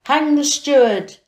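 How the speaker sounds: stern command. Acted. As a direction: order it from the bench. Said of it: "the governor said".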